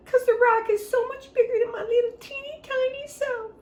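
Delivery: very high, weepy little voice with laughing sobs; a tiny, tearful berry wailing; crying cranberry. Acted. Cranberry sobs her escape plan to the child, very high and teary. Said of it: "And she said".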